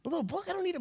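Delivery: very high incredulous squeak; John baffled by a little book; mock disbelief.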